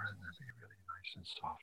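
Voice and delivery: gentle hushed whisper. Shown as a demonstration. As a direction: whisper it, nice and soft. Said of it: "you want to whisper".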